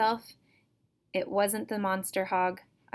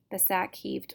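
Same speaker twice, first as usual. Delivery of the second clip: usual speaking voice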